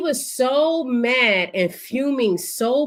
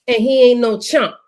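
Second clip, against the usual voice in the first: normal talking voice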